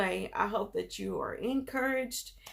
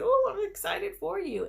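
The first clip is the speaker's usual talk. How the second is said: excited; 12 semitones higher, 5 dB louder than usual. Retold or imitated. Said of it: "And they said".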